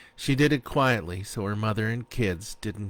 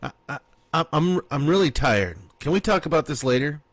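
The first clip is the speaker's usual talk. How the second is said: higher, rushed and stammering; nervous stalling; 6 semitones higher, 5 dB louder, faster than usual. A narrator's reading. Husband dodging his wife, nervous and stammering. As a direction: stammer out an excuse to escape. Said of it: "husband stalling his angry wife".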